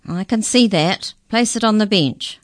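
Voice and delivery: gravelly creature. A narrator's reading. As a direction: order him about as an officious creature. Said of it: "unpleasant, gravelly voice".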